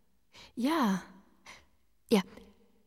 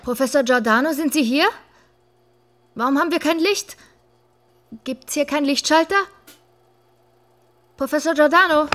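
loud, higher calls into the dark; raised searching calls; calling out; 8 semitones higher, 7 dB louder than usual. Acted. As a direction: call for him across the room.

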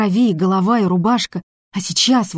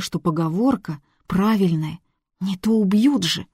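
ordinary delivery

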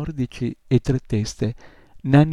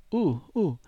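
exclaimed uh-uh; 7 semitones higher than usual. Acted. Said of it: "esclamò".

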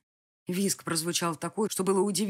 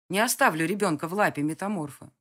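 usual speaking voice

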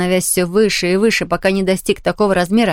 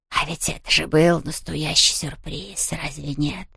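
slow, soft whispery ghost; ghostly whisper; 3 semitones lower, 7 dB quieter than usual. A narrator's reading. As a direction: explain it like a smug ghost. (шёпот привидения)

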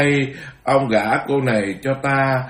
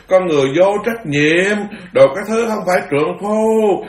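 high, loud hired-heckler tirade; mocking street heckler yelling abuse at the monk; ranting abuse; 5 semitones higher, 3 dB louder than usual. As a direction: hurl insults down the street. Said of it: "Nó chửi".

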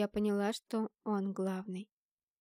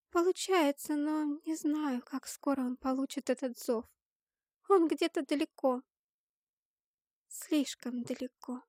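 higher, slowing, fading at the end; sad little girl; 5 semitones higher than usual. A narrator's reading. Girl reporting that he is too far away, sad and higher-pitched. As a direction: answer sorrowfully, on the edge of tears. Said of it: "печально ответила Зарина и разревелась".

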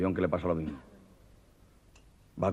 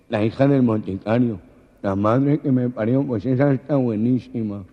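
louder, slow leering remark; lightly voiced village man; 4 semitones higher, 9 dB louder than usual. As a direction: say it like an envious local. Ramonet reacts, loud.

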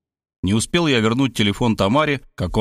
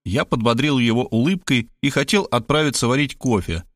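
ordinary delivery

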